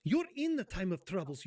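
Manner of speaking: ordinary talk, nothing marked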